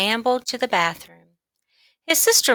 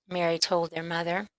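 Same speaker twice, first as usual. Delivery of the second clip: usual speaking voice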